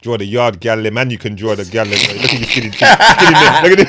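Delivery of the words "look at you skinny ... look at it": high, loud Caribbean auntie, laughing. Retold or imitated. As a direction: tease like a doting Caribbean auntie.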